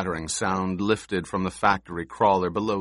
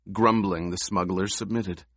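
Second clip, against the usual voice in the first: plain reading voice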